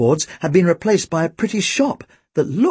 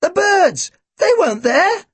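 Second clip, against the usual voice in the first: very high, squawking protest; high-pitched, agitated boy's outcry; parrot-like cry; 4 dB louder than usual. A narrator's reading. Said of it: "he sounded remarkably like a parrot himself".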